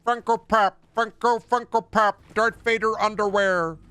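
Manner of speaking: high, squawky mock consumer chant; mocking parody. Mocks what nerd culture has become.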